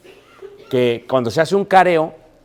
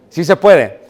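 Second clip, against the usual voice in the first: emphatic mock proclamation; 4 semitones higher, 8 dB louder, slower than usual. Preacher mocking the excuse.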